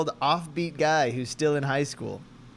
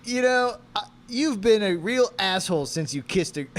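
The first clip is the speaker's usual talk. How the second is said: loud, raised-pitch accusation; acted anger; 6 semitones higher, 4 dB louder than usual. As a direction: start an angry accusation that falters. First take of quarrel line.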